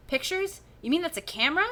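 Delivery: put-on high, puzzled kid voice; startled young girl asking; surprised girl voice. Stacy voiced in story.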